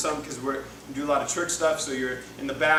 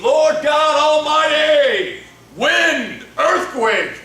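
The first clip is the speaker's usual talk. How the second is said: huge high shout, each word drawn out; hammed-up superhero call, booming and slow; Power Rangers battle cry; 12 semitones higher, 16 dB louder, much slower than usual. Preacher mocks what listeners expect of God, superhero shout. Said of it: "Like Power Rangers".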